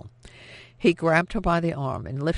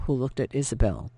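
ordinary delivery

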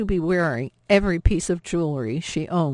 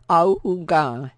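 car horn imitation; 6 dB louder, slower than usual. Automobile honked its horns.

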